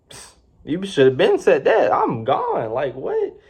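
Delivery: high, sassy, incredulous; sassy retort. Retold or imitated. Delvin scoffing at his late-dismissing manager, sassy. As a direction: toss it back with mock disbelief.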